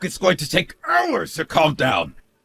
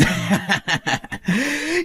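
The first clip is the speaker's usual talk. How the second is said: high, rising mocking laugh; derisive laugh; 4 semitones higher than usual. Husband warning his wife not to get cocky, derisive laugh. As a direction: laugh down at her, rising in pitch.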